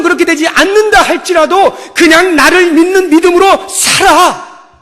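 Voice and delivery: loud, impassioned preaching voice; fervent preaching. Acted. Preacher voicing God to the congregation, loud and fervent. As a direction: press the point home with force. Preaches God's reply at full force.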